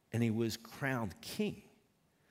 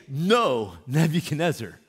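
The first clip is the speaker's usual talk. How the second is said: emphatic retort; 2 semitones higher, 10 dB louder than usual. Retold or imitated. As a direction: fire back the answer with full force. Preacher voicing a wrong reply.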